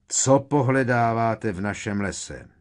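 loud, merciless challenge; harsh demand. A narrator's reading. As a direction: call out a stern demand to intruders. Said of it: "zaborácel šedivý kentaur s nemilosrdným výrazem". (hlasitý, nemilosrdný dotaz)

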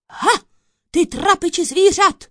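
high, loud accusing outburst; triumphant shouted accusation; lashing out. A narrator's reading. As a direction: pounce on the accused loudly. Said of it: "uhodil soudce na kouzelníka".

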